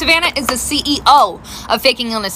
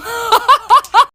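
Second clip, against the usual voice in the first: high laugh; 10 semitones higher, 4 dB louder than usual. Real, not acted. Mom laughs at story end.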